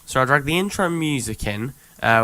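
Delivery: normal talking voice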